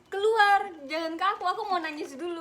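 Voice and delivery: tearful push away. Retold or imitated. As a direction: shout them out of the room while close to tears. Retells pushing friend away crying.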